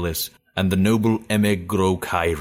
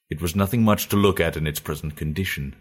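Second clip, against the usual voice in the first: ordinary delivery, nothing marked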